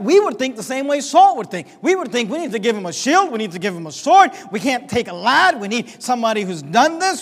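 loud, driving preacher's cadence; preaching. Acted. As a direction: preach it hard to the congregation. Preacher building up to a point.

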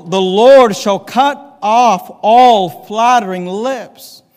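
drawn-out, loud scripture line; slow proclaimed scripture. Acted. Preacher proclaiming the verse to the church, slow and loud. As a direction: stretch every word of the verse.

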